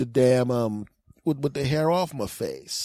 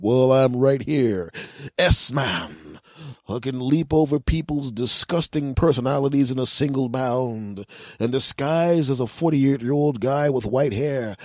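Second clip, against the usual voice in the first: mock superhero; 3 semitones lower than usual. S-Man instructing the young lady, pompous. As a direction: proclaim it grandly, stretching key words. Playing S-Man declaiming his powers.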